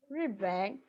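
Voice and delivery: "Riben" quietly, Ri falling, ben dipping; fourth and third tone. Shown as a demonstration. Teacher repeating the model to the class. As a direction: repeat "Riben" with clear tones. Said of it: "It is the fourth tone and the third tone".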